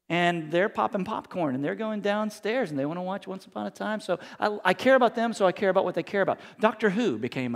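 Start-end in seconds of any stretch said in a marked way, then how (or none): none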